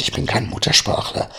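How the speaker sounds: usual speaking voice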